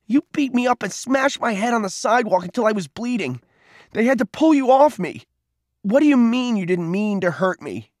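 high-pitched, heated accusation; wounded, outraged boy; wild-eyed outrage. A narrator's reading. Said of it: "His eyes were wild".

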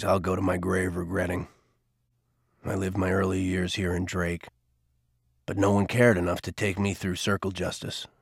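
a hint of a weary older man; Garvey, remorseful. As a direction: confess it quietly, weighed by the past. Garvey's confession.

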